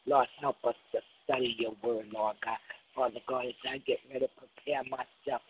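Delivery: ordinary talk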